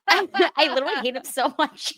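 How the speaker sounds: high laughing rant. Real, not acted. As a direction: laugh through the words. Laughing while ranting about Chase.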